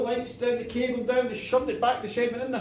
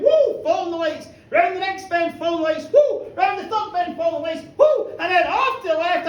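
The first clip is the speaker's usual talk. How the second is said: loud, high whoa at each bend; wild whoops; 7 semitones higher, 7 dB louder than usual. Acted. Narrator voicing the drunk driver, shouting whoa. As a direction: ride the bends shouting whoa.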